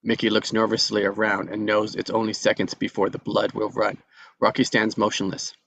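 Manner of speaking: ordinary talk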